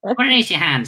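high puppet voice, puzzled; teddy playing dumb about the hand. Puppet voice again.

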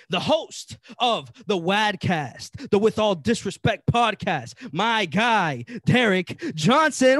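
slow, loud fight announcer intro; ring announcer. Acted. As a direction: stretch it out like a UFC announcer. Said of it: "you were even breathing like that guy from the U F C shit".

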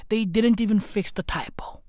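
whispered gossip aside; mock whisper. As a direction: whisper it like a scandalous secret. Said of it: "whispered gossip".

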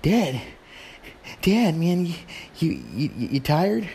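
put-on young son. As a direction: play a kid checking on his tired dad. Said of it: "he's young, so he's all like".

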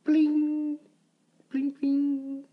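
high, loud metallic plim sound effect; high ringing plim. Acted. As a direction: ring it out like a screw hitting the floor. Screw hits the floor.

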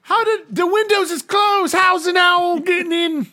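very high, loud comic guard voice; hammed-up shouting of bumbling guards; startled guards. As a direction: squawk like alarmed British guards. Game master plays startled palace guards.

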